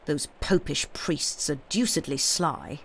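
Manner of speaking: ordinary delivery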